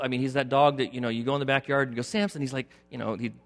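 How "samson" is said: calling the dog. Retold or imitated.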